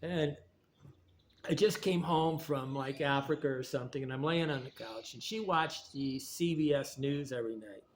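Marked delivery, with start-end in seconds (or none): none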